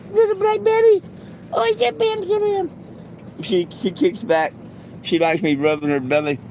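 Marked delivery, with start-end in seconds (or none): 0.1-2.7 very high falsetto teasing; playful pet talk. Pretend. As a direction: squeak it playfully at the dog. Playful song name for dog.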